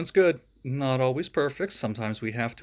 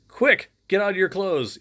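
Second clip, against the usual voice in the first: higher, louder urgent command; 6 semitones higher, 6 dB louder than usual. Acted. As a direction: hurry them out of the slimy clothes. Egon urging the others.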